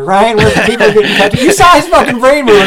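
loud, high, laughing cut-in; urgent interruption. Real, not acted. Cutting off Dave before he argues, loudly urgent. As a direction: shout over him to change the subject.

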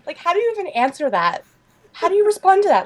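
normal talking voice

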